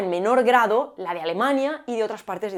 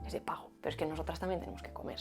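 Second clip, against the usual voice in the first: whisper; 4 semitones lower, 15 dB quieter, faster than usual. Acted. Aside about paid podcast.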